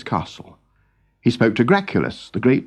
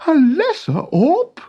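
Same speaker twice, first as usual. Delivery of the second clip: laughing. Acted. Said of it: "laughed Noggin".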